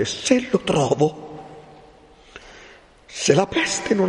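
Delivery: high, impassioned threat in bursts; vengeful fury. Acted. Renzo swears he will do justice himself, furious. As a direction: climb to a very high cry of revenge.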